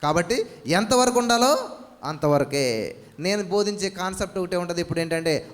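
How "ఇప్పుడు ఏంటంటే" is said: normal talking voice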